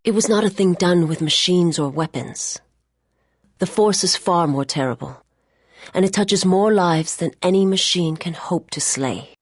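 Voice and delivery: grave proclamation. Acted. Solemn Force speech.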